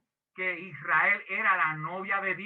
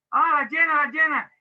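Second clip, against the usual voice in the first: high, loud eager answer; eager chorus; 8 semitones higher, 7 dB louder than usual. Retold or imitated. Women answering which virgins they want to be, high and eager. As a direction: shout it like a keen churchgoer.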